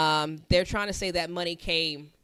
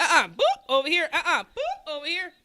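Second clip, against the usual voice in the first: raised-pitch playful mimicry with popping boops; playful deflection mimicry; 7 semitones higher than usual. Friend mimics someone dodging every accusation, playful deflection. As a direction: bounce it away with cartoonish boops.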